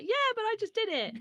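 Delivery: high-pitched imitated excuse; high, pleading mock defence; mock protesting husband.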